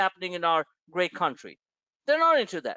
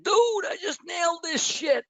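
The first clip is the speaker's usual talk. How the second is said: excited shout; 9 semitones higher than usual. Retold or imitated. Soldier texting the host from a war zone, shouting triumphantly. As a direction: whoop it out like a soldier who just scored. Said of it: "It's like".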